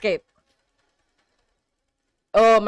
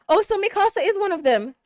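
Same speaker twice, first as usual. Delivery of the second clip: ordinary delivery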